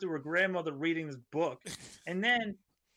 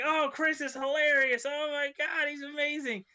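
high-pitched mock gushing; gushing crowd; 8 semitones higher than usual. Wedding crowd praising the groom's joke, mock gushing. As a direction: imitate delighted guests, pitched well up. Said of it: "everyone in the crowd is like".

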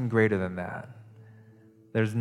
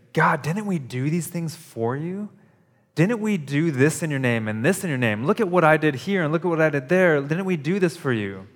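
higher, mock-proud pleading; a bragging hypocrite fishing for credit, lightly voiced; a hint of a whiny hypocrite; self-righteous hypocrite; 6 semitones higher, 3 dB louder than usual. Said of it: "That's a hypocrite talking".

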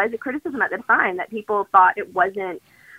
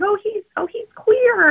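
mock shocked parents; 12 semitones higher than usual. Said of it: "What they're like".

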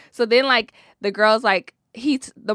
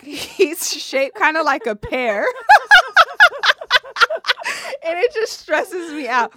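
laughing; 10 semitones higher, 8 dB louder, slower than usual. Real, not acted. Laughing while describing boyfriend.

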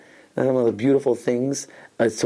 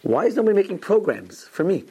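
imitated protest, pitched high; earnest retold complaint; 8 semitones higher than usual. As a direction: quote his heartfelt grievance in a raised pitch. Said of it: "he meant, like, a hundred percent".

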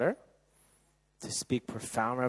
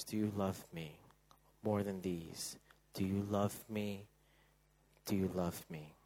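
tender questioning; 4 semitones lower, 8 dB quieter than usual. Acted. Jesus's gentle repeated question.

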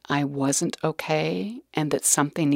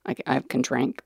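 normal talking voice

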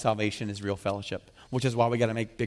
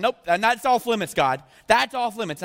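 mock refusal; 8 semitones higher, 5 dB louder than usual. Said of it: "you're going".

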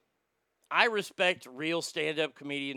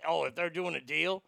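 ordinary talk

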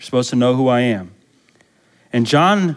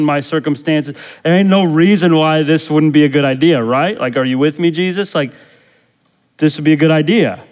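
acted complaint, pushing higher as it goes; insistent pleading; 5 semitones higher, 5 dB louder than usual. Imprisoned John pressing Jesus to free him, acted as insistent pleading. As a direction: reason urgently with someone who could help.

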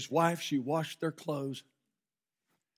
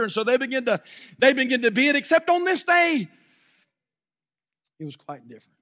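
loud, rising sermon storytelling; fiery revival preacher telling a story; preaching at full force; 8 semitones higher, 10 dB louder than usual. Acted. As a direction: preach it to the whole room. Preacher tells slave auction story.

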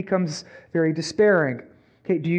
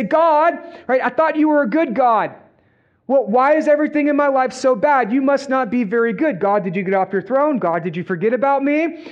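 mock-angry complaint, pitched up and louder at first; angry at God; 6 semitones higher, 4 dB louder than usual. Churchgoer blaming God for a bad life, acted angry. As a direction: act out an aggrieved challenge to God. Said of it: "then you become angry at God".

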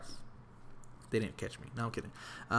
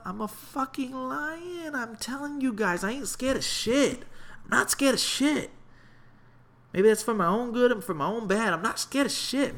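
fearless boast; 9 semitones higher than usual. Real, not acted. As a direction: pump yourself up like a lion. Boasting he fears nothing.

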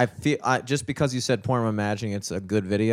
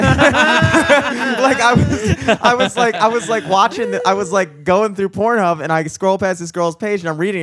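much higher and louder, tumbling out through laughter; giddy laughing retelling; 8 semitones higher, 8 dB louder, slower than usual. Real, not acted. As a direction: blurt it out, barely holding in the laugh. Laughing excitedly telling porn story.

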